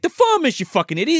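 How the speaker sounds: high yelled insult; loud high snap. Retold or imitated. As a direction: bark it like an annoyed mother. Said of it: "He's like".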